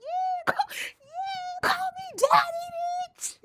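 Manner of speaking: very high, shrill strained squeal; squealing high dom voice. Retold or imitated. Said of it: "and I'd be like".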